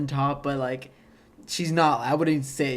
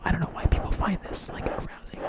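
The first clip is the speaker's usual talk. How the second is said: ASMR whisper; 4 semitones higher than usual. Shown as a demonstration. Podcaster demos ASMR for his cohost, whispering. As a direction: whisper it close to the mic like an ASMR video. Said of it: "I'm gonna do A S M R right now".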